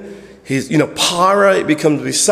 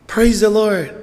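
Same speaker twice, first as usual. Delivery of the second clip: bold praise; 6 semitones higher, slower than usual. Shown as a demonstration. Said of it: "You don't praise the Lord like this".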